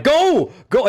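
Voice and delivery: high, loud urging; excited mock cry; urgent shout. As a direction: yell it like urging a movie character to run. Viewer urging character to flee.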